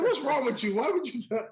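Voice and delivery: a lightly hammed-up dad scolding; playful imitation of an exasperated father; mock scolding father. Father scolding, voiced in story.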